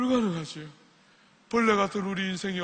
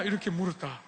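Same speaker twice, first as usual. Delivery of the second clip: ordinary talk, nothing marked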